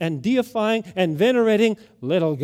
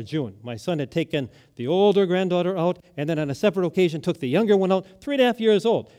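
ordinary talk

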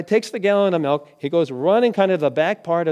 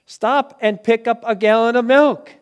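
loud, slow, higher-pitched order; insistent repeated command; 6 semitones higher, 7 dB louder, slower than usual. Retold or imitated. Unseen voice orders the driver who ignored it, loud and insistent. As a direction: press the command hard, drawing it out. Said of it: "hears this voice again".

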